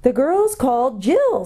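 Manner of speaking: high, slow owl hoot for deaf ears; owl calling out. A narrator's reading. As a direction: spell it out in long high hoots. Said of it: "said the owl as loud as it could".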